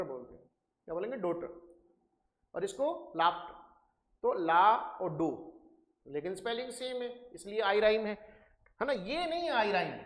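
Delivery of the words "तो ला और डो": ला and डो drawn out very slowly; teacher contrasting sounds; contrasting vowels. Shown as a demonstration. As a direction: stretch ला and डो to show the vowel difference. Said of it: "डॉटर बोलते हो या डाटर".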